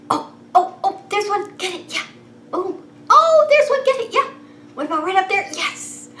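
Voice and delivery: high playful excitement; excited catching. Acted. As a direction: squeal while catching kisses. Catching blown kisses excitedly.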